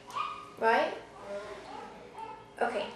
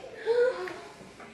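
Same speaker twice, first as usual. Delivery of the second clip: surprised gasp; 9 semitones higher than usual. Real, not acted. Reacting to picture.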